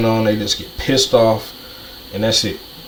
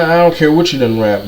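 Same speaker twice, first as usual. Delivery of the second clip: ordinary delivery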